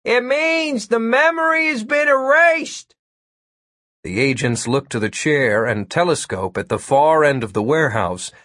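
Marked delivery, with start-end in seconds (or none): 0.0-3.0 high-pitched calling from far away; distant shout. A narrator's reading. Hacker answers agents from afar, distant high shout. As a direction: call it out as if across a warehouse. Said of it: "A distant voice shouted".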